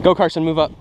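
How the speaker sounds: ordinary talk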